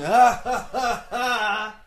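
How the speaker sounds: high, drawn-out fake laugh into a taunt; slow, exaggerated sneering heckle; mocking jeer. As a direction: jeer like a nasty audience member. Mimicking jeering audience at failed comic.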